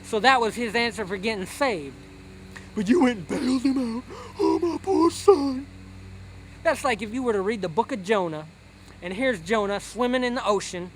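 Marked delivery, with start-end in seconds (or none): 2.8-5.7 doting mother wailing over her poor son, mock tearful; mock crying mother. Mother defends bailing son.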